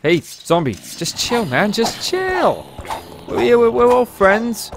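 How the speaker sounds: high, slow calming voice; hammed-up pleading with a zombie; soothing zombie talk. Talking to a zombie mob.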